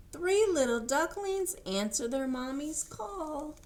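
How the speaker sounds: animated read-aloud voice; reading to children. A narrator's reading. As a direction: read it out bright and sing-song for little ones. Reads picture book aloud.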